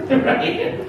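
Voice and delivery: high breathy laugh. Real, not acted. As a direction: laugh through the word. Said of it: "laughing at own joke".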